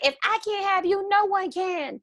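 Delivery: put-on high, pleading ex girlfriend voice; clingy ex girlfriend. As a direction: play the possessive jilted girlfriend. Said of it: "she's like".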